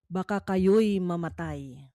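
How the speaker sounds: normal talking voice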